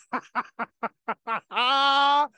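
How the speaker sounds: loud burst of laughter; hearty laugh. Real, not acted. Laughs at the memory.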